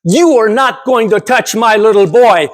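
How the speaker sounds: put-on defiant Czechoslovakian-accented mother refusing the doctor; thick Czechoslovakian accent. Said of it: "in her thick Czechoslovakian accent and said".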